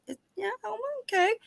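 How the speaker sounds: very high, fast mock-fragile mom voice; hammed-up nervous mother; evasive mom. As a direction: squeak it out like a mom hiding bad news.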